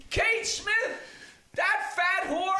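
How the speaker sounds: repeating the heckler line in a squeaky voice; muttered heckle. Retold or imitated. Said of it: "leaned over off mic and said".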